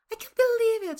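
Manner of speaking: high, loud burst of tearful joy. Acted. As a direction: blurt it out overjoyed through happy tears. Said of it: "crying tears of joy".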